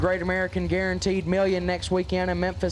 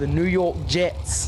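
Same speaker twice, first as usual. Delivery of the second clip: put-on New York accent; slower than usual. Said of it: "something I can pronounce in a New York accent".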